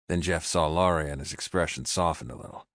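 usual speaking voice

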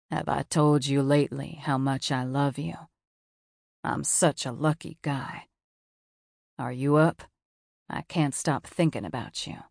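lightly voiced loving boyfriend; boyfriend's texts. A narrator's reading.

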